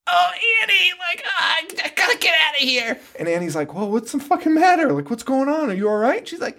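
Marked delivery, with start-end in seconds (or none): 0.1-3.0 high, frantic mock Lori voice; hammed-up panicked girl, high and fast; toned-down screaming Lori. Mocking the actress, high frantic Lori voice. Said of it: "I'm doing it at, like, a toned down version". 3.7-6.3 high mocking friend voice; mock Annie. Friend asks Lori what's wrong, high mock voice.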